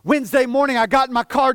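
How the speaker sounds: normal talking voice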